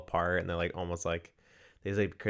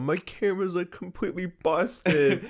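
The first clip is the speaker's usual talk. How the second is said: freaking out; 11 semitones higher, 6 dB louder than usual. Retold or imitated. Kid in the back of the car after the crash, freaking out. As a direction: imitate a hysterical kid wailing in distress. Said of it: "all the kids are freaking out".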